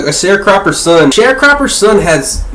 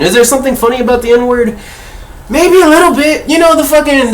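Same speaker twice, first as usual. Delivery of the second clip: higher, quick playful question; teasing; 7 semitones higher than usual. Real, not acted. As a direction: tease it, half laughing.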